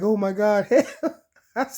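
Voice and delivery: high, loud cry for help through laughter; hammed-up panicked plea, laughing; laughing mock panic. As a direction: plead for help while cracking up. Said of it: "And I'm like".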